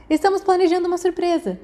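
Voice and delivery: high bright eager announcement; pleased with himself, grinning; beaming. A narrator's reading. Peter announcing a surprise to Remus, grinning. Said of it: "sorrindo amplamente".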